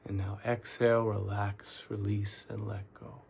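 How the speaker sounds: soft, unhurried meditation guide with long pauses; calm, quiet guiding voice; guided meditation. Real, not acted. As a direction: talk them gently through tensing and letting go, leaving space between phrases. Leads guided relaxation meditation.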